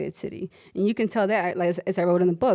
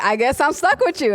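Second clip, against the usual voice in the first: higher, resigned sigh of a line; grudging patient; 7 semitones higher, 4 dB louder than usual. Retold or imitated. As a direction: give in reluctantly. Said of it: "she's like".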